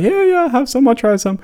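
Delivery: high keen acceptance; keen policeman. Retold or imitated. Policemen accepting English tea, high and keen. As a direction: sound like a cop happy to get tea.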